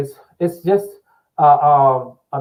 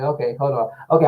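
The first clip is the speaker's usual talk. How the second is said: usual speaking voice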